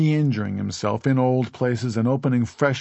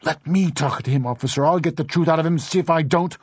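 high, louder angry mother; indignant cry; 7 semitones higher, 5 dB louder than usual. A narrator's reading. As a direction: cry out as if demanding your turn at him. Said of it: "Missus Doolin cried".

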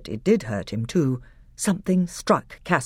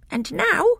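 a much higher, pinched voice for the bitter dwarf; sour dwarf, high and hard. Acted. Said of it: "said Knickerbrick".

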